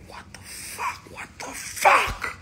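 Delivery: voiceless hiss swelling to a forced whisper-yell; stunned whispered outrage. Retold or imitated. Man shocked in the moment, whisper-screaming.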